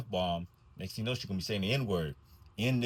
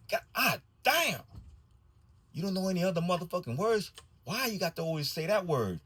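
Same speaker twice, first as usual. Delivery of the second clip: exasperated outburst; 11 semitones higher than usual. Pretend. Bus driver imagines yelling at the swearing girl, exasperated. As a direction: vent at the kid in exasperation. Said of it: "nah, I ain't say that, but I want to".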